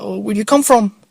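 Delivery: high, loud and pushy; loud street stranger. Retold or imitated. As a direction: fire the question up high and loud. Stranger asks origin.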